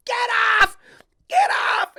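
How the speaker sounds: high, loud mock yell; mock shout. Church staff to comic on stage, high mock yelling. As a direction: shriek him off the stage. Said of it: "waving the light like".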